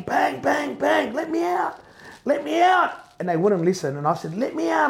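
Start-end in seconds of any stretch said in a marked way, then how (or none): 0.0-2.9 high-pitched retold pleading from the van; exaggerated panic. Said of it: "I exaggerated my panic attack". 4.4-4.9 high retold plea for release; exaggerated panic. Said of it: "I exaggerated my panic attack".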